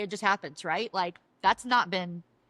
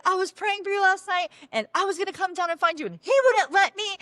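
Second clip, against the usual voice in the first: high, gushing retelling; excited outburst; 8 semitones higher, 4 dB louder than usual. Retold or imitated. Woman tells Dawn she prayed for Dawn, excitedly. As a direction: pour it out to a long-sought friend, pitched up.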